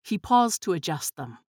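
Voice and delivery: ordinary delivery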